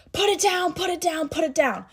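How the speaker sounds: high, urgent repeated command; commanding shout. Retold or imitated. Boyfriend shouting at the man to drop it, urgent command. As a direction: order him to drop the lighter.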